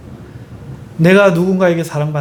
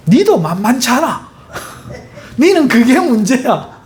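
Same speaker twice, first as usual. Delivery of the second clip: high, laughing tease at his wife; husband laughing off his wife's gossip; teasing husband; 7 semitones higher than usual. Retold or imitated.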